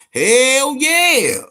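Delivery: loud, high hell yeah; hyped. Real, not acted. Agreeing with his brother, loud and high hype. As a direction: pump it up loud.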